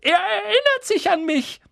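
squeaky, very high, loud boast; beside-himself little man; excited cry. A narrator's reading. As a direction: announce it to the whole room, overjoyed. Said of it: "rief Daedalus Dickel und blickte in die Runde".

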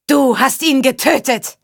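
accusing cry. A narrator's reading. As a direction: hurl the accusation from afar. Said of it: "rief Jorinde". (hoch und anklagend gerufen)

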